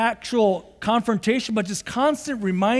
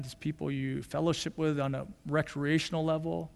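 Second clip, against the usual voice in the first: prayerful reflection; 8 semitones lower, 6 dB quieter than usual. Acted. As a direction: draw them inward, gently hushed.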